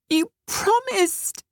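very high, slow tearful reproach; hurt reproach. A narrator's reading. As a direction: cry it out betrayed. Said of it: "she began".

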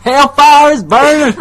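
high, loud, drawn-out wail; hammed-up yell, stretched out; mock agonized yell. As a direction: cry out in comic torment. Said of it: "he's yelling this".

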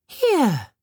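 high airy fairy offering a gift; light, high and bright; tinkling fairy voice. A narrator's reading. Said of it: "said the birch fairy".

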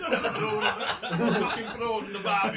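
put-on loud, laughing go at a South African accent; mock South African accent. Riffing on the prawn joke, laughing South African accent. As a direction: crack up through a bad South African accent. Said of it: "I'm doing my South African".

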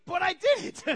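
high, loud blurted confession; mock admission. Person admitting they never studied, high loud blurt. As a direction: punch out the failure for laughs. Repeated punchline admitting the failure.

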